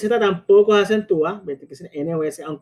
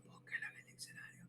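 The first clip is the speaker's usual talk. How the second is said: a quick voiceless whisper; mock whisper; 32 dB quieter, faster than usual. Teacher telling Clarence to look it up, whispered. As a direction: rush it out as a hushed aside.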